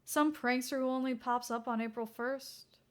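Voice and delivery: usual speaking voice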